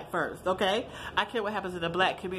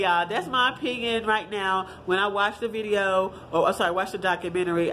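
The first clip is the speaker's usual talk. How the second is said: usual speaking voice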